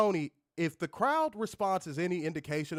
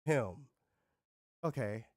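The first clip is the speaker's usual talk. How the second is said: deflated crowd; 8 semitones lower, 6 dB quieter, slower than usual. Retold or imitated. Mocking crowd's letdown.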